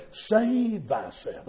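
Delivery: slow, sly tempting Save thyself; whisper-soft cunning lure. Retold or imitated. Satan's cunning suggestion, slow and sly. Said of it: "in this cunning fashion".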